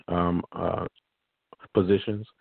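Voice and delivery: normal talking voice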